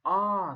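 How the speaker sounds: "ah" as a long open ah; long ah sound. Shown as a demonstration. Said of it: "It's called".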